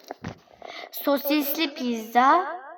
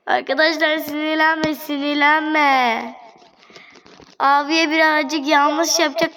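pleading; 3 semitones higher than usual. Real, not acted. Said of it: "pleading with the customer".

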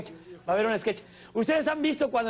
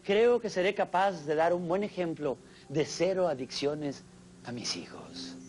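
ordinary talk

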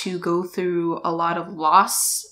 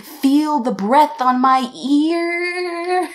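higher, slow sing-song lilt; 7 semitones higher, 4 dB louder, much slower than usual. Retold or imitated. Vlogger telling viewers about the breath, sing-song. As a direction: savour the creepy detail in a lilt.

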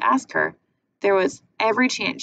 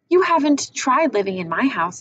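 indignant protest; 6 semitones higher, 5 dB louder than usual. Acted. Bruno protesting to Schmuel.

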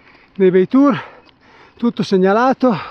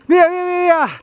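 excited urging; 10 semitones higher than usual. Real, not acted. Urging himself off at the start.

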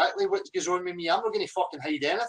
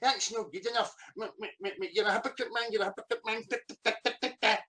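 softer, muttery mocking whine; whining; 3 semitones higher, 6 dB quieter than usual. Man mimicking his rival's complaints, mocking whine. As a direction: mimic a petty whiner, babbling under your breath. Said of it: "like a little fucking whining bitch".

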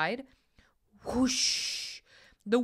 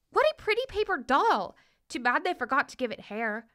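put-on little girl voice; 5 semitones higher than usual. A narrator's reading.

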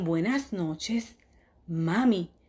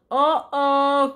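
mock alarm; 5 semitones higher, 7 dB louder than usual. Dinosaur throws teddy bear.

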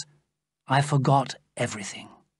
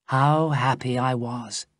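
ordinary delivery, nothing marked

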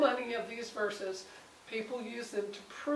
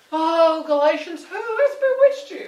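loud, much higher cry of disbelief; exasperated outcry; 7 semitones higher, 14 dB louder than usual. Retold or imitated. Paul crying out to the straying Galatians in exasperation. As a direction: throw up your hands and cry out at them.

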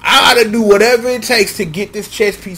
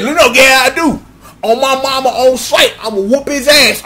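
worked-up threat; 4 semitones higher than usual. Acted. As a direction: pump yourself up into a furious vow. Escalating threats against Birdman.

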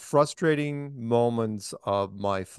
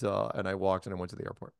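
ordinary talk, nothing marked

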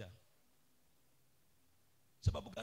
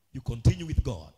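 ordinary talk, nothing marked

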